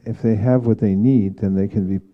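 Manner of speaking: normal talking voice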